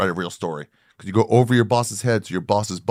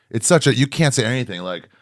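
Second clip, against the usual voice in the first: usual speaking voice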